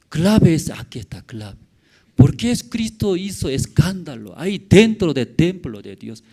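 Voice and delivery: ordinary talk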